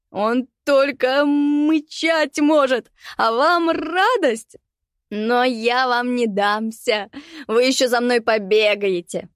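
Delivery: high, slow, unhinged cackling voice; mad laughing rant. Acted. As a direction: rant through manic laughter. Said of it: "безумно хохоча". (безумный хохочущий крик)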